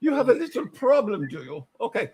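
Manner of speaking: light mock German shrink accent; Freud accent. Friends playing Freud over drinks, mock German accent. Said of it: "that Freud accent".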